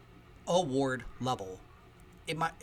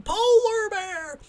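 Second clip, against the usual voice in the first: very high, loud drawn-out holler; Wild Bill screaming; 11 dB louder, much slower than usual. Retold or imitated. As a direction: scream it like an eccentric man spotting a friend. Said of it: "he's just screaming".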